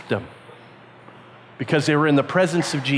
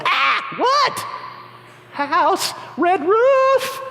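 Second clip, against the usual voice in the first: high, shocked cry of discovery; startled disciple; 13 semitones higher, much slower than usual. Acted. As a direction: gasp out the surprise, pitched way up. Disciple finds the house.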